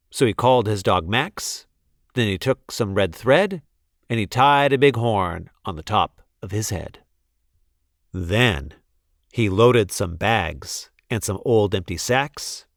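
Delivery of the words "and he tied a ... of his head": ordinary delivery, nothing marked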